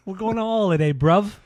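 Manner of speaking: put-on high, loud London lad caricature accent; hammed-up English "bruv" accent; mock English accent. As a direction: call it out like a cheeky English bloke, in mock accent. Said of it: "You just say "bruv" at the end".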